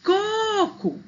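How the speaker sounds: COCO with the first CO held very high; calling the word. Shown as a demonstration. Teacher showing the penultimate stress, calling COOco. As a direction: call out coco, long on the first CO. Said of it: "sílaba forte é a penúltima".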